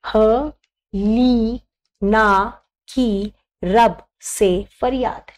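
slow, spaced-out dictation; teacher dictating a trick slowly. Shown as a demonstration. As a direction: say it very slowly so they can write. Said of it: "मैं बहुत आराम से बोल रही हूँ".

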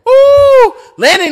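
high, loud "ooh" at the punch; sudden thrilled howl; excited yelp. Real, not acted. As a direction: squeal at the landed hook. Huge punch reaction.

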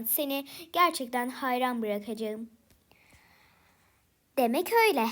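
ordinary delivery

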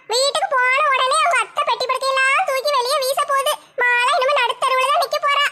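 delighted busybody savouring the quarrel; sly neighbour's gleeful aside; scheming gossip neighbour. Acted. Gossip neighbour gloats over fight.

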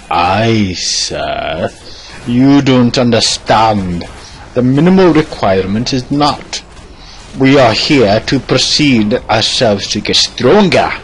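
slow, declaiming mentor voice; lofty master character. Acted. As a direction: proclaim it grandly, stretching the words. Plays master lecturing pupil.

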